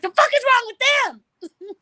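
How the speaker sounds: high, loud outraged comeback; still hot, cussing. Retold or imitated. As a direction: throw the question back at a shout. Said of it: "I'm still cussing".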